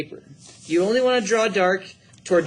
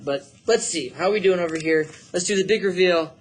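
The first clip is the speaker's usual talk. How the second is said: ordinary talk, nothing marked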